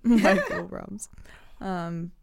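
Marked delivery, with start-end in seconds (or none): 0.0-1.1 repeats it through a laugh; chuckling echo of the joke. Real, not acted. Cohost echoes the joke, laughing.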